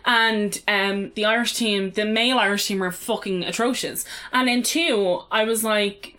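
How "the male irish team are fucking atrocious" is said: usual speaking voice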